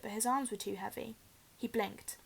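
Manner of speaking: ordinary delivery, nothing marked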